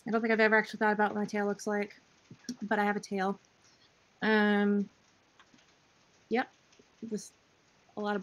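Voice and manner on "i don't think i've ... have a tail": ordinary talk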